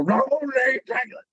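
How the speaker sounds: high, exaggerated Southern accent; mocking Southern accent. Stand-ins mocking Rickman's line, high exaggerated Southern accent. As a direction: ham up the Southern accent to mock him. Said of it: "the thing we made fun of him with".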